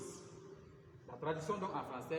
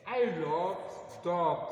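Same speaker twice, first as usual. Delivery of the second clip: "I love dogs" loud and clear; example said loudly; 7 dB louder, much slower than usual. Shown as a demonstration. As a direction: read the example out to the class loudly. (« I love dogs » lu fort)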